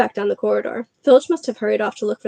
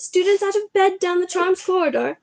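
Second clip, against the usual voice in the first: high, ringing alarm call; bellowing; 9 semitones higher than usual. Acted. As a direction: shout it down the corridor. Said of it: "Peeves bellowed".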